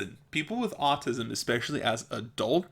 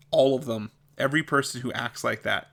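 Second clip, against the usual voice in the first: usual speaking voice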